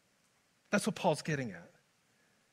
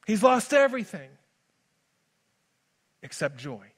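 ordinary talk

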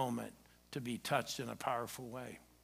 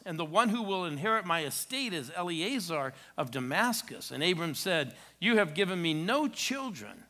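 raised solemn reading voice; scripture reading; 3 semitones higher than usual. Acted. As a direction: read the passage out to the standing church. Reads scripture aloud.

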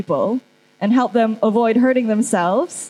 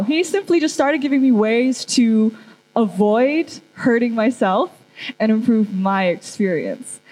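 normal talking voice

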